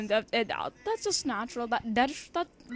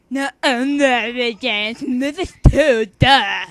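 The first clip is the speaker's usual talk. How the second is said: much louder, slow mocking voice with a lisping tongue; mock him by tonguing every word; mocking tongue-heavy voice; 3 semitones higher, 18 dB louder, slower than usual. Girl mocking the boy, lisping every word. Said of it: "mock me by adding tongue to every word".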